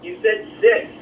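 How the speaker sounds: choppy phone line cutting out; breaking up space radio. Real, not acted. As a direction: voice dropping out like bad reception. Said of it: "you're breaking up a little bit there".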